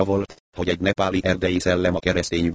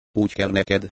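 ordinary delivery

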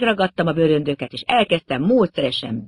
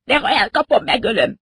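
angry threat; 8 semitones higher than usual. A narrator's reading. Hardy threatens attacker.

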